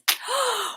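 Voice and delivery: high sharp gasp; amazed gasp. Acted. Bo amazed that the pup speaks, high gasp. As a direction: gasp in wonder.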